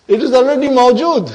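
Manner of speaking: high, loud and breaking into laughter; high-pitched amused emphasis; amused exclamation. Real, not acted. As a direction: call it out loudly with a laugh. Teacher laughs at obvious answer.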